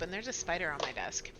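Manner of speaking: ordinary talk, nothing marked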